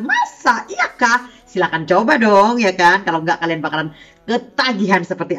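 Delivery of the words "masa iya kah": mock surprise.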